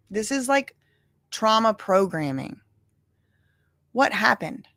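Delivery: ordinary talk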